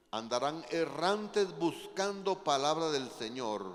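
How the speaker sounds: loud, slow, weighty Bible reading; proclaimed scripture reading. Real, not acted. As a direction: declaim each verse slowly and forcefully, stretching key words. Preacher reads scripture aloud.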